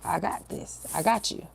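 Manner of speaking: soft, nearly whispered inner voice; hushed reassurance. Retold or imitated. Spirit reassuring her during the phone call, hushed. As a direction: quietly take it off their hands. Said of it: "I felt so strongly in my spirit".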